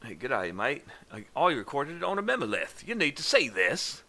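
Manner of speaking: hammed-up Australian accent. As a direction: lay the Australian on thick. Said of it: "that's not even right".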